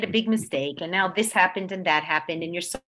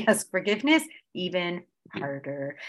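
usual speaking voice

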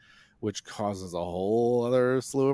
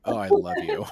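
much higher, louder delighted gush; gushing nurse; 7 dB louder than usual. Retold or imitated. Nurses gushing over her big vein, high delighted squeal.

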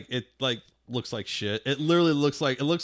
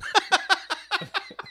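very high squeal of laughter. Real, not acted. Bursts out laughing.

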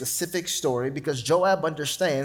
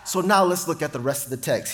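normal talking voice

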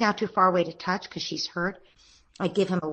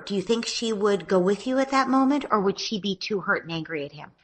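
normal talking voice

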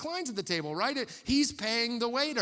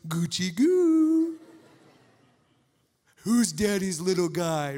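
put-on baby talk; 8 dB louder, slower than usual. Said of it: "he goes".

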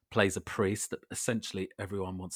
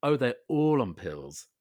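mock realization; 3 semitones higher, 7 dB louder than usual. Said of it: "And it was like".

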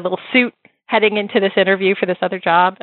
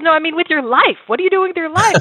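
higher, pointed mentor's question; Deborah voice; 10 semitones higher than usual. Retold or imitated. Deborah pushing Jessie about her life, higher insistent voice. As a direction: press her on her life choices, pitched up. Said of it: "She goes".

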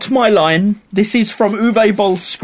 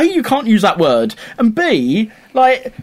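ordinary talk

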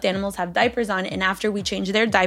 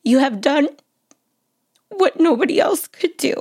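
higher, halting, tearful reading; real emotion rising in a letter reading, long pauses; choking up; 5 semitones higher, 4 dB louder than usual. Real, not acted. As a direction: fight back tears between phrases. Breaking up while reading letter.

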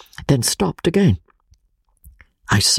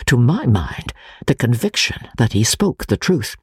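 plain reading voice

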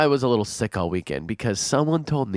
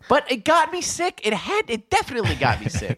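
indignant; 11 semitones higher than usual. Real, not acted. Indignant complaint about flu shot.

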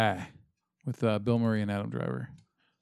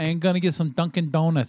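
higher, hammed-up Massachusetts accent; bad Massachusetts accent; 6 semitones higher, 4 dB louder than usual. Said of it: "bad actors that don't know how to do".

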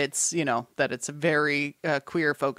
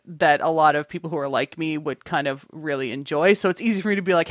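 usual speaking voice

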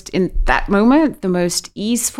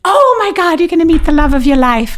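much higher and louder, rushing it out, a gushing fortune teller; excited psychic; 11 semitones higher, 6 dB louder than usual. Retold or imitated. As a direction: blurt the prophecy fast, thrilled. Psychic excitedly reading the cards.

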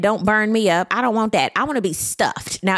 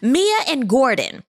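ordinary talk, nothing marked

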